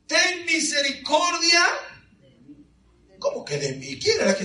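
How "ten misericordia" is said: high, loud, slow cry of Ten misericordia; slow high shout; shouting for mercy. Retold or imitated. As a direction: beg for mercy with full voice. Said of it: "Oigan lo que dijo".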